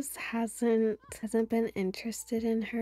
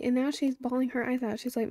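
normal talking voice

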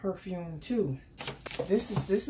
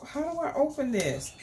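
ordinary talk, nothing marked

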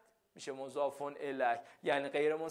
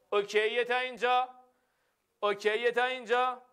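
energetic check-in; 6 semitones higher, 4 dB louder than usual. Real, not acted. Teacher checking class understands.

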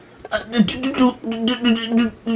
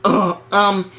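stuttering; 9 semitones lower than usual. Acted. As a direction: get stuck on the first sound. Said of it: "You were stuttering the whole time".

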